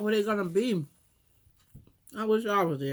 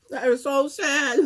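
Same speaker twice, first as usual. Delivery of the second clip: mock sad; 5 semitones higher, 6 dB louder, slower than usual. Mock lament about bathroom need.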